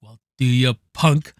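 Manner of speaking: easy, almost playful threat; casual imitation of a cool cop line; lighthearted Clint Eastwood line. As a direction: toss it off lightly, half amused. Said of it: "almost lighthearted".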